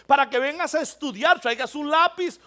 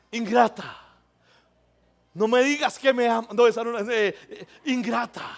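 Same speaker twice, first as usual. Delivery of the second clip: heartbroken man; 3 semitones lower than usual. Retold or imitated. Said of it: "si alguien está despechado".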